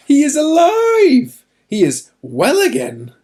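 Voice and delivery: high, loud shout of good news; joyful cry. Acted. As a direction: burst out with the happy news. Servants bring joyful news.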